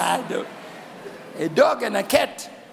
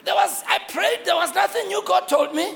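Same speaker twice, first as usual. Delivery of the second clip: ordinary delivery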